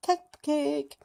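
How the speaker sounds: high delighted cupcake. Real, not acted. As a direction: savour the word happily. Celebrating a treat.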